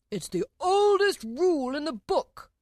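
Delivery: high, loud, slow royal voice; insisting King. A narrator's reading. King argues with Alice over the rule, loud insistence. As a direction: insist loudly as an indignant king.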